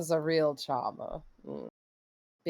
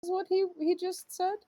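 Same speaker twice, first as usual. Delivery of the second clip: incredulous; 13 semitones higher than usual. Real, not acted. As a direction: voice jumps up in disbelief. Incredulous question about "monster.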